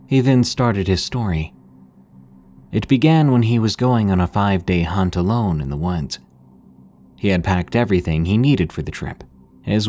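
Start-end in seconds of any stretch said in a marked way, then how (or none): none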